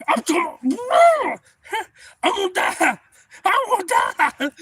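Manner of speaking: very high, loud wail; hammed-up screaming panic. Scalded man sure he's gonna die, hammed-up screaming panic. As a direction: wail it out in comic agony.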